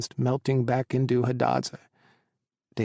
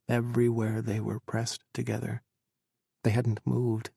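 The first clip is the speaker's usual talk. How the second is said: usual speaking voice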